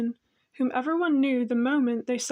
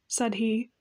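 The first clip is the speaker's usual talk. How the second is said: usual speaking voice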